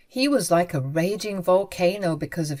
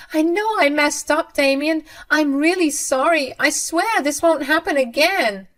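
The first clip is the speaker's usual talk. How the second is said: much higher, louder begging mother's voice; desperate pleading mother; 8 semitones higher, 3 dB louder than usual. Acted. Mother begging Damien and his wife Winter, high desperate plea. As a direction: plead frantically for forgiveness.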